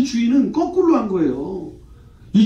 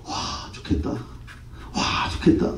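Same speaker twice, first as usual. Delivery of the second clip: hushed, half-voiced wistful envy; soft acted awe; envious onlookers; 9 dB quieter, much slower than usual. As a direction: murmur it under the breath like a jealous bystander. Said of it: "주변 사람들이 어떻게 한다고요?".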